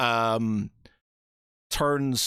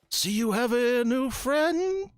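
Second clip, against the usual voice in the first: much higher, slow, suspicious paladin voice; a haughty knight eyeing a stranger slowly; 13 semitones higher, slower than usual. Acted. GM as High Paladin questioning a newcomer, high and slow.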